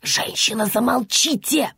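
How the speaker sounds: irate hiss at a noisy neighbour. A narrator's reading. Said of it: "прошипела полная тётка".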